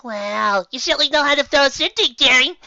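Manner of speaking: high, loud theatrical society voice; high loud diva praise; posh lady. Acted. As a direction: gush like a diva. Posh mare compliments host.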